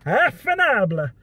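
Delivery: high, loud mock exclamation of the word; imitating a fake Italian dad; dad's catchphrase. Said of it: "he used this one".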